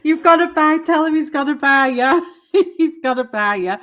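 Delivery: loud, high, laughing; excited laughing urging. Real, not acted. Streamer urges a viewer's partner to buy, laughing loudly. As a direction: egg him on with glee.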